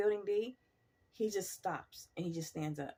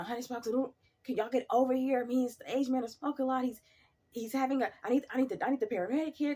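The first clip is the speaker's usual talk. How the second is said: urgent radio call; 7 semitones higher, 3 dB louder than usual. Retold or imitated. Calling for help on radio.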